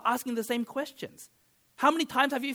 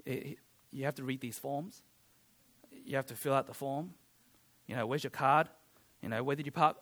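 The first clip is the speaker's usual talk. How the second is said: bored receptionist; 9 semitones lower, 8 dB quieter than usual. Retold or imitated. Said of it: "the receptionist is like".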